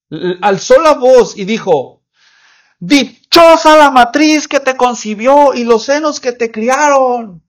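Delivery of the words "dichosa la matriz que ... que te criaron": high, loud cry from the crowd; a woman calling out over the crowd, slow at the end; a woman in the crowd cries out praise to Jesus, high and loud. Acted. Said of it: "Así grita".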